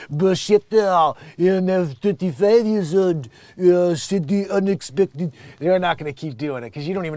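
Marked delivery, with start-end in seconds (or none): 0.1-5.3 slow, loud mock French accent. Mocking his own viewers, in a hammed-up French accent. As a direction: ham up a thick French accent. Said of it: "the French accent".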